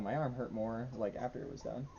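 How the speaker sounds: usual speaking voice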